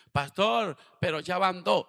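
high, loud anguished cry; desperate plea. Retold or imitated. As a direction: cry out in distress to the pastor. Desperate sister pleads with pastor. (hermana angustiada clama al pastor: grito alto y desesperado)

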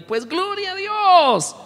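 high, slow, drawn-out praise; joyful praise. Retold or imitated. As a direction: rise up sweetly on the praise. Pastor answers gossip with praise.

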